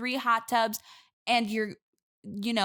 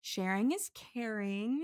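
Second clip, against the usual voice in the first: sing-song motto; 3 dB quieter, slower than usual. Acted. Passive-aggressive cheerful motto.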